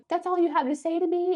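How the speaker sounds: high, indignant disbelief; shocked. Retold or imitated. Lyra stunned by Hades' casual question, high indignant. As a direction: gasp out the outrage.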